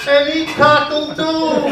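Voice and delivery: high, slow, loud crowing with a laugh; horny rooster crow. Pretend. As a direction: crow again, cracking up. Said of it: "the cry of the horny chicken".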